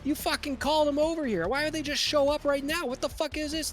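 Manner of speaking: high-pitched, furious-sounding threat and accusation; acted yelling. Game master as Jimmy waving a gun at the orc, high yelling. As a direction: yell accusations in a high, worked-up voice. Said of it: "And he's still yelling".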